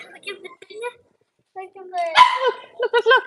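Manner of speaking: high pet voice. Real, not acted. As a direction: catch the puppies' eye. Getting puppies' attention.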